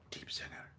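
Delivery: barely audible, fearful-rumour whisper. A narrator's reading. Game master voices a dreaded name passed among dwarves, whispered. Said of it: "whispered out".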